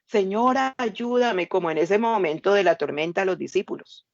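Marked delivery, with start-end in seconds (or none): 0.1-1.5 higher, urgent cry for help; desperate plea. Acted. Believer in a storm of trouble pleading, desperate. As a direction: beg the Lord for rescue.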